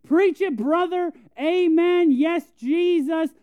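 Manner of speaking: high, loud, slow church-lady amens; hammed-up amen-corner lady egging on the preacher. Preacher mocking a pew lady amening the pastor, high and loud. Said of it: "lady that sits in church and amens the pastor".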